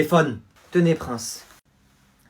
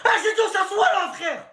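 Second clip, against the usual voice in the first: very high-pitched, strained order; hammed-up exasperation, voice way up high; exasperated teacher. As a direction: squeal the order, losing patience. Teacher loses patience with class.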